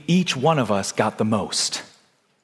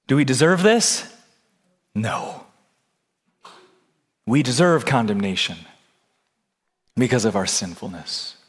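normal talking voice

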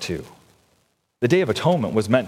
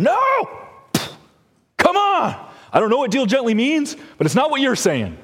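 exasperated shout; 13 semitones higher than usual. Retold or imitated. Preacher voicing the justice person shouting back, exasperated. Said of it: "the justice person is going".

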